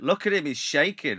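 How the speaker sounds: loud, high voice of a fussing woman; mock a bystander pointing him out, loud and high; woman in the queue. Woman in the queue points at the shaking fan, loud and high.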